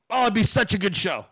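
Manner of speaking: ordinary talk, nothing marked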